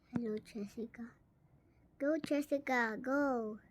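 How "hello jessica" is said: softer whispered hello. Real, not acted. Gamer greeting Jessica, whispering. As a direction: greet her under your breath.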